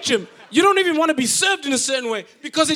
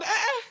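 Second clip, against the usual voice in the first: wincing eh; 8 semitones higher, 7 dB quieter than usual. Retold or imitated. Mock wince at the pastor.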